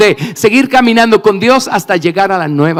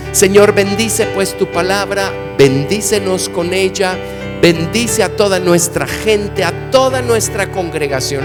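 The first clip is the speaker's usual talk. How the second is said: earnest, steady prayer voice. Acted. Interpreter leads a prayer. (oración fervorosa)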